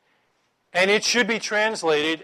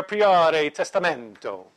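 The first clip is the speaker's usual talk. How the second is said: slow, louder mock-Italian Latin; Italian accent; 6 dB louder, slower than usual. Teacher reading Vulgate titles to the class, hammed-up Italian accent. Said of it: "with an Italian accent".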